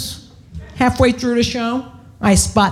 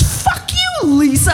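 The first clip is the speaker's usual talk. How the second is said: squeaky high-pitched retort; high-pitched God voice; 9 semitones higher, slower than usual. Retold or imitated. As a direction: shriek it up high. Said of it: "he goes".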